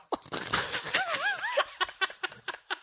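long high cackle of laughter; can't stop laughing at himself; long high-pitched laughing fit. Real, not acted. Laughing at own story.

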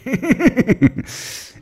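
loud high-pitched giggle. Real, not acted. Comedian laughing at his own joke, high giggle.

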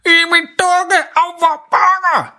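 high, slow, mangled words through a scalded tongue; garbled "I min dukke, au, hvor bonger" from a burned tongue; burned-tongue speech. Acted. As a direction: talk as if your tongue is burned. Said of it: "Det er lidt svært at forstå, hvad han siger, fordi han har brændt sin tunge".

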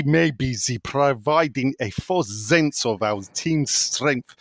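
a shaky attempt at a German accent. Reading a listener's question aloud in a mock German accent. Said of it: "read this in a German accent".

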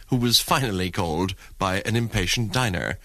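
ordinary talk, nothing marked

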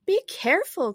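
high, urgent warning; crying out. A narrator's reading. Alice warns the Queen about her brooch, crying out. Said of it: "cried Alice".

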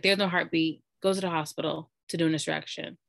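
ordinary talk

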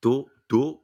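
attempted Iranian accent on dour mikham. Shown as a demonstration. [name] trying the Persian phrase in an Iranian accent. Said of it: "You sound just like my dad".

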